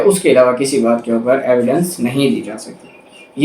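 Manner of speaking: ordinary talk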